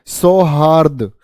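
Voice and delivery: सौहार्द said slowly, each syllable clear; slow careful pronunciation. Shown as a demonstration. Teacher to students, slowly spelling out सौहार्द. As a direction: sound out सौहार्द slowly for the class. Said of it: "सौहार्द की भावना पैदा करो".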